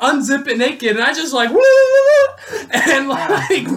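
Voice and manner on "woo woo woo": high, slow whooping with laughter; mock party whoops. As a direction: whoop like a wild partier. Running naked into the tent.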